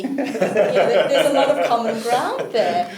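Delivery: talking through loud laughter, slowed; laughing. Real, not acted. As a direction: laugh your way through the line. Laughs about rugby joke.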